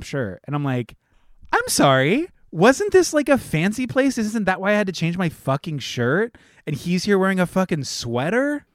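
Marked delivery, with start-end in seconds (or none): 1.5-8.7 high, loud incredulous questioning; acted-out outraged disbelief; indignant. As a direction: protest the double standard, voice pitched way up. Retelling indignation at brother's clothes.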